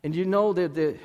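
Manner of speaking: usual speaking voice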